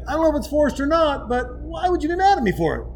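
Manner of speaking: mocking the man high and self-excusing; mock whiny creep.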